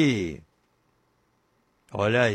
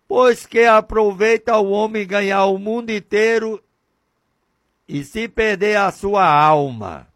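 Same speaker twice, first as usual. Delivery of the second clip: louder proclaimed Bible verse; scripture quote; 3 semitones higher, 5 dB louder than usual. Acted. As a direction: declare the verse to the crowd.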